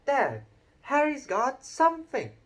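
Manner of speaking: put-on high, loud tattling boy; whiny Dudley. A narrator's reading. Dudley tattles to his dad about Harry's letter, high whiny voice.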